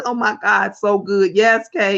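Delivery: normal talking voice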